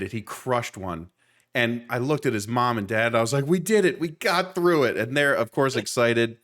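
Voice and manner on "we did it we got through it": higher-pitched relieved cheer, not shouted; pitched-up retold triumph; retold excitement. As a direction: celebrate a shared win with the parents. Retold celebration to kid's parents.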